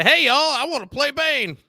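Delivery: a Texas cowboy riding in to pitch himself; Texan accent. Retold or imitated. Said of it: "I'm from Texas".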